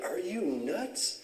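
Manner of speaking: imitating friends, pitch climbing on a slow question; mock disbelief, voice rising high; incredulous friends. Friends questioning his choice to go there, mock incredulous. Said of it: "His friends were like".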